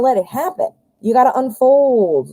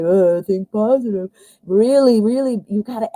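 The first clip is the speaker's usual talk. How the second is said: put-on voice for a trite slogan; mock cliche. Reader showing the wrong way to do positive thinking, mock cliche. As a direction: parrot the motto as an empty cliche. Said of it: "not just doing the cliche".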